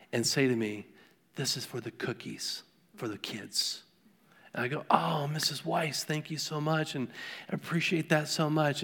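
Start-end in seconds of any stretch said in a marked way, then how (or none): 1.3-3.8 put-on hushed, near-whispered little old lady voice; soft elderly lady. Pastor voicing the elderly church lady handing him a dollar, hushed. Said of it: "she's just a petite, tiny, uh, elderly lady". 4.9-8.8 hushed thanks. Retold or imitated. Pastor thanking the old lady softly.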